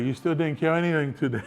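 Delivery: high, needling retort; teasing comeback. Retold or imitated. Choked-out man still ripping into his buddy, teasing. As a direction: keep taunting him, pitched right up.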